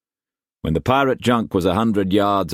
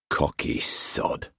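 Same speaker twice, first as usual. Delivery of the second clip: whispered, slow thought; inner whisper; 7 dB quieter, slower than usual. A narrator's reading. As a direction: breathe it to himself. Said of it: "He looked back at the dinghy".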